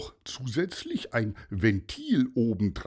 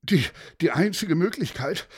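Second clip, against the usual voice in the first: puffing pilot; 6 semitones higher, 3 dB louder than usual. Acted. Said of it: "schnaufte der Ballonfahrer".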